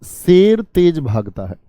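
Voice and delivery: slow, deliberate dictation pace; dictating slowly. Shown as a demonstration. Teacher dictating an example sentence to students, slow and measured. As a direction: spell it out slowly as you write it on the board. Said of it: "मैंने लिखा".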